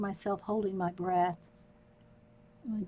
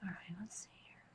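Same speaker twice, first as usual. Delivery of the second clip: whisper; 17 dB quieter, faster than usual. Real, not acted.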